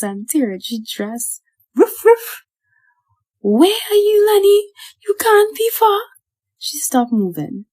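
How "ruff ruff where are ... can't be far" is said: high, loud tearful calling; crying, scared. A narrator's reading. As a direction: call out lost and frightened. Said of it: "started to cry".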